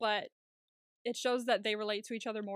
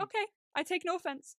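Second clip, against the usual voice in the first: higher, breezy mock-cheerful reply; 8 semitones higher than usual. Capheus answering Sun cheerfully, higher voice. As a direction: chirp it back, unbothered.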